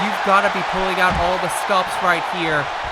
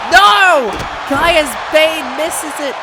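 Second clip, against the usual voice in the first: high, loud shout, then slow; excited shout; 8 semitones higher, 7 dB louder, much slower than usual. Real, not acted. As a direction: scream the miss. Finisher narrowly misses.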